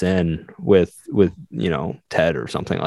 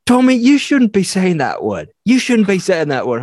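high, louder mock-Australian scolding; imitated Australian accent; 11 semitones higher, 4 dB louder than usual. Australian Navy mechanic tells off a coworker for swearing, imitated Australian accent. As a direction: put on an Australian accent, pitched up. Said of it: "guy who's in the Australian Navy".

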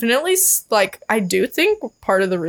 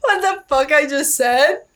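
laughing; 5 semitones higher, slower than usual. Real, not acted. Laughing at own slip.

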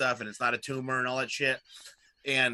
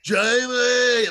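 loud, high Arnold shout of a name; hammed-up Arnold yell; 10 semitones higher, 9 dB louder than usual. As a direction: yell the name like Arnold calling his son. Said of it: "when he yells his son's name".

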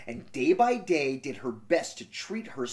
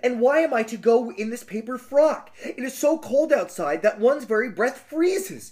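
put-on higher, pleading young girl; 7 semitones higher, 3 dB louder than usual. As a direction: beg in a high girlish voice. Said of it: "said the girl".

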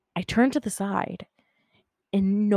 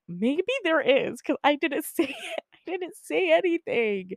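high-pitched, giggly quote; laughing, high voice of a friend; laughing retold reply; 9 semitones higher than usual. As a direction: voice the spooked friend through giggles. Friend's spooked reply, told through laughter.

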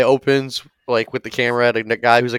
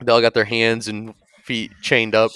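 ordinary talk, nothing marked

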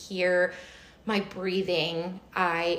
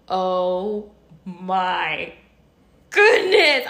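overwhelmed relief; 6 dB louder, much slower than usual. Retold or imitated. Traveler reliving the exit door, drawn-out overwhelmed exclamation. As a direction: draw it out, then burst.

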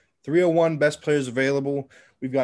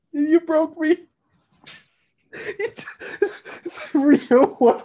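laughing; 11 semitones higher, much slower than usual. Real, not acted. Still giggling after breaking.

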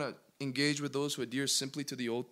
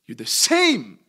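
loud burst with a leap on the last word; exclaimed realization; 11 dB louder, slower than usual. Acted. Preacher reacts to Jesus as our Savior, exclaimed realization. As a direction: burst out as if it just dawned on you.

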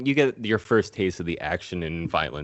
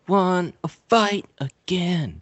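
higher, slow, lightly mocking voice; bored Sub-Zero; 7 semitones higher, slower than usual. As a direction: shrug off the win in a lazy put-on voice. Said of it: "and he's all like".

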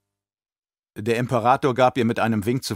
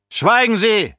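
much higher, louder roar; roaring command; 13 semitones higher, 8 dB louder, slower than usual. Acted. Emperor roars at the baron to be silent. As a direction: bellow him into silence.

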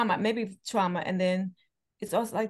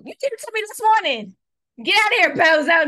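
loud, high teasing yell at a friend; playful mock outrage, high and loud; mock scolding yell; 8 semitones higher, 10 dB louder than usual. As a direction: yell it as a joking insult. Joking scold of Gemini friend.

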